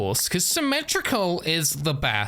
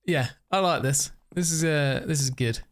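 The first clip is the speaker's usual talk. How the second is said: ordinary delivery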